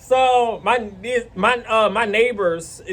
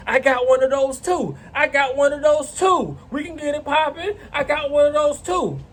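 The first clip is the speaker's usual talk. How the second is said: retold defensive bluster; 6 semitones higher than usual. Neighbor shouts threat from cover.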